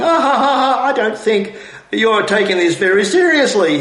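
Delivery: high mock laugh into the words. The senator dodging Hanson's question, laughing. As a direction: laugh through it to dodge the question. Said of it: "he ultimately escapes the question by laughing".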